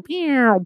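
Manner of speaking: high mock laser blast; laser pew. Podcaster imagining a VR dogfight, mock laser pew. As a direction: shoot a toy laser.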